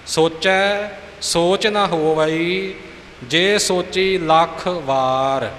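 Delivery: ਸੋਚੈ and ਸੋਚ kept apart, said slowly with full ੈ; slow careful recitation. Shown as a demonstration. Teacher models the correct reading of ਸੋਚੈ ਸੋਚ. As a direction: recite each word separately, unhurried. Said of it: "ਸੋਚੈ ਸੋਚ ਇਕੱਠਾ ਨਹੀਂ ਹੈ".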